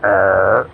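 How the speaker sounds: low rumbling mock stomach growl; imitating a stomach growling all night. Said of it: "My stomach is like, growling all night".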